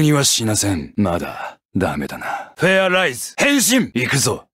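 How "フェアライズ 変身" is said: high, ringing heroic call; transformation call. Acted. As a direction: announce the change with force.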